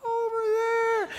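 very high, drawn-out far-off call; thin, stretched mock holler from afar; imitated distant yell. Stranger in the water yelling up to a cliff jumper, imitated distant yell. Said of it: "he's yelling at me".

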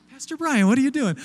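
mock alarm at catching him; startled bystander. Churchgoer catching the pastor measuring tires, mock startled.

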